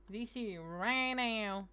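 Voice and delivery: D C right now stretched out slow; insisting, very slowly; slow drawn-out demand. Shown as a demonstration. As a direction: drag out each word of D C right now, demanding.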